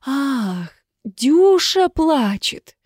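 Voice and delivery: high, slow dawning exclamation; sudden realisation. A narrator's reading. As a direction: it finally clicks, let the pitch leap up. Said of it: "Потом до неё дошло".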